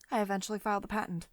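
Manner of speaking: normal talking voice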